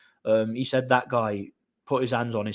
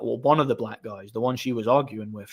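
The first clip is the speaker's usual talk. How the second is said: ordinary delivery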